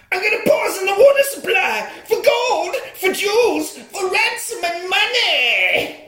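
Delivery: mock evil villain, laughing as he boasts. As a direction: gloat like a cartoon baddie. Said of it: "he's clearly the evil villain of the piece".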